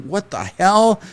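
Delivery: high-pitched, startled what-the-hell; shocked yelp. Retold or imitated. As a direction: yelp it as the gun kicks. Shocked by recoil.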